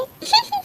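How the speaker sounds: a high short spiteful laugh; mocking cackle. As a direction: laugh at the ugly girl. Aunt laughs after insult. (ऊँची तीखी हँसी)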